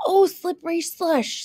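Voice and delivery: high, exasperated outburst; exasperated protest. Acted. Little Red defends the wolf to the woodcutter, exasperated. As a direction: burst out in protest.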